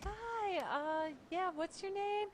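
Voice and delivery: high, bright mock-polite voice; high-pitched pretend politeness; fake friendly. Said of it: "Or I'll be like".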